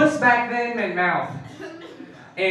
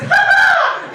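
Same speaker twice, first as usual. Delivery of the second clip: very high, loud squeaky giggle; tiny cartoon laugh, pitched way up; dough-man giggle; 9 dB louder than usual. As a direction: giggle like a poked little doughboy. Little dough man giggles when poked.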